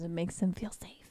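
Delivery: soft whispered aside; hushed and breathless. Real, not acted. Whispered remark about dogs.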